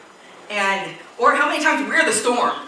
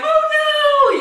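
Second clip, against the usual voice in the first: high, loud mock panic; comic frightened shriek; panicked cry; 8 dB louder than usual. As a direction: yelp it like a terrified sailor. Disciples panicking in storm.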